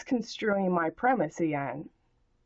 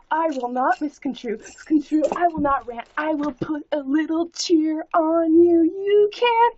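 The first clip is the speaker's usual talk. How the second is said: higher and louder, sing-song mock cheer; mock cheerful ditty; 6 semitones higher, 5 dB louder than usual. Answering the troll, mock cheerful ditty. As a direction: put a little cheer on it. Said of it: "How was that for a little ditty?".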